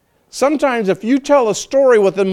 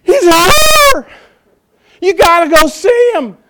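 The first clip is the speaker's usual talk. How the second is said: much higher, loud, gasping with joy; breathless excitement; 10 semitones higher, 7 dB louder, slower than usual. Retold or imitated. The woman calling the townspeople to come, breathlessly excited. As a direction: shout the news while out of breath. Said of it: "She's out of breath".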